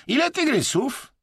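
very high, insistent question; pressing. A narrator's reading. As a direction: keep up the interrogation. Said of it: "naciskał Chińczyk". (natarczywie, bardzo wysoko)

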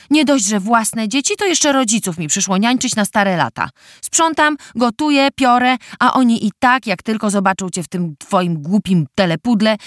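loud, high angry nagging; angry sister. Acted. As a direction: scold her like a fed-up sister. Siostra robi wyrzuty przez telefon. (rozzłoszczona siostra)

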